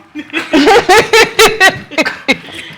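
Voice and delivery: big burst of real laughter; loud high laugh. Real, not acted. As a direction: crack up loudly, high. Laughing at retold insult.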